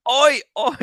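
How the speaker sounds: put-on London accent. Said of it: "do your London accent for me".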